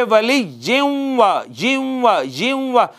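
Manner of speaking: "जिम्वा" with no n, a nasal glide into w; इदगाम के साथ. Shown as a demonstration. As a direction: hum from ji into the wa of "जिम्वा". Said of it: "पढ़ेंगे". ("जिम्वा" में तनवीन को वाव में मिलाकर)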